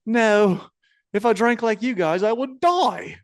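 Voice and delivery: high-pitched imitation of a woman refusing; put-on high voice, mock-appalled refusal; Rhea Ripley. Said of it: "And she goes".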